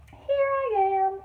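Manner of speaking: high, loud, slow reassuring call; little sister finding lost Sheila, high slow call; sister's answer. Acted. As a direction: announce yourself to rescue her.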